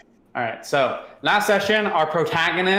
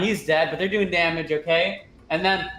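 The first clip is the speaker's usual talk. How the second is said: ordinary delivery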